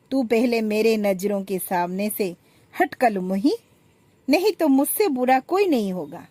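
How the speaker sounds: high, loud scolding, shooing her away; angry shout. Acted. Elder daughter-in-law shooing younger one away, loud angry scolding. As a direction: bark her out of sight with scorn.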